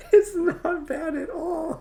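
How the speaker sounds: laughing, high and slow. Real, not acted. Laughing to viewers at her own panic. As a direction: laugh it out in relief. Laughing relief after story.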